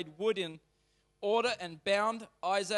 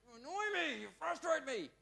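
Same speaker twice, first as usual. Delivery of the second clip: put-on high, exasperated parent fed up with the kids; frustrated groan; 8 semitones higher than usual. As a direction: let the frustration spill out, pitched way up. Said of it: "when you are frustrated and you're like".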